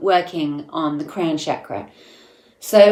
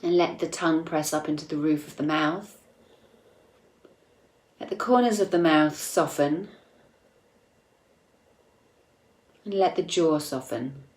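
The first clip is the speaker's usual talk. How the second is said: soft, unhurried meditation voice with long pauses; calm meditation guide, gradually growing softer; guided meditation; 7 dB quieter than usual. Acted. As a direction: guide listeners inward gently, leaving space between phrases.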